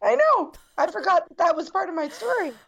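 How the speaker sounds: very high, overcome with feeling; real tearful surprise, pitch soaring; emotional. Real, not acted. Guest realizing it was part of her story, overcome. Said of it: "I'm gonna get emotional".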